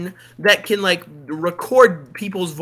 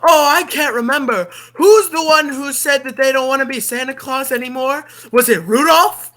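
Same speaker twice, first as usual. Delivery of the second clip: higher, louder flustered showman voice; higher, louder mock Martin Short fluster; Martin Short impression; 6 semitones higher, 4 dB louder than usual. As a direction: play a dithering showbiz host, higher. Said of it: "Martin Short goes like".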